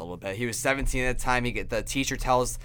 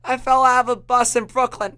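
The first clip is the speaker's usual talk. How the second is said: laughing through it; 12 semitones higher, 9 dB louder than usual. Retold or imitated. Retelling mom's absurd answer.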